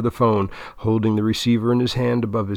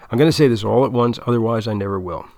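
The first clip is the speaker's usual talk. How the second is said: rushed confession; 3 dB louder, faster than usual. Acted. Had blurting confession.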